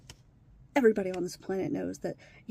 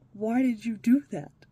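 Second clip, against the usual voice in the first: louder exasperated mock question; retold exasperated question; 8 dB louder than usual. Asking the stepdaughter why she turned her phone off, louder. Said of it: "And I'm like".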